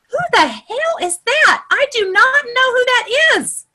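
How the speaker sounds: high, exaggerated incredulous thought; mock bafflement. Trainer voicing her reaction to forgotten online friends, mock bafflement.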